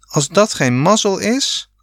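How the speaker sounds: put-on enthusiastic boy's call, pitched high; excited cry. A narrator's reading. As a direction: cheer it out like great luck. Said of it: "riep Rob". (enthousiast hoog uitgeroepen)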